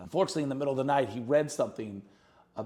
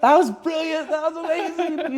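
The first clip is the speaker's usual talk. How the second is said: ecstatic praise; 10 dB louder than usual. Retold or imitated. Hugging wife in relief.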